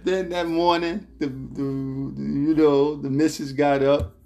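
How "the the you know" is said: normal talking voice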